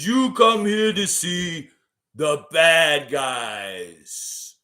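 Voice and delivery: drawn-out, loud wrestler catchphrase voice; Scott Hall as Razor Ramon. Shown as a demonstration. Streamer fulfilling an impression request, loud slow wrestler voice. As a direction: stretch every word like a swaggering wrestling villain. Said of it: "Could you do an impersonation".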